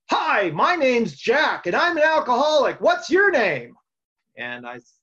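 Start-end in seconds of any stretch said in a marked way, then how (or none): 0.1-3.8 high, loud, slow beaming greeter; Jack's big greeting. Retold or imitated. Old-timer greets a newcomer with a huge, loud welcome. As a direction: stretch it out like a grinning old-timer. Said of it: "And he goes".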